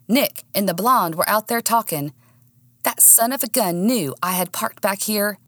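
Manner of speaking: usual speaking voice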